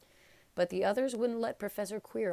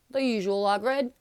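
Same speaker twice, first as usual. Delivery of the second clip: raised, hearty barman greeting; 5 semitones higher, 10 dB louder than usual. A narrator's reading. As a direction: call it out like a friendly barkeep. Bartender greets Hagrid.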